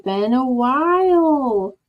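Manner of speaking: put-on slow, stretched Australian accent on "Been a while"; bad Australian accent. Streamer greeting her chat again in a bad Australian accent. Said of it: "My Australian accent has not gotten any better".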